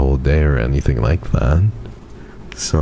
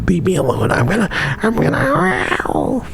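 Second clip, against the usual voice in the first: squeaky, far higher growly wolf whine; little alpha wolf; 14 semitones higher than usual. Shown as a demonstration. Streamer shows chat how she sounds in pain: a tiny alpha wolf. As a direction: play a tiny alpha wolf warning everyone off. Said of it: "alpha wolf sounds".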